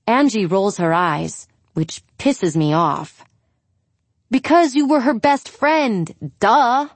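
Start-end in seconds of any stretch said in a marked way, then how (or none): 4.3-6.9 sarcastic. Acted. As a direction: snap it back with scorn. Julia snapping at eye-rolling Angie.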